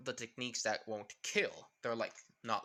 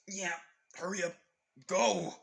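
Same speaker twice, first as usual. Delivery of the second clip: high, building to a loud cry; acted urgent shout; urgent command; 5 semitones higher, 6 dB louder than usual. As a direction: urge them on, then yell the last word. Acted urging to escape quickly.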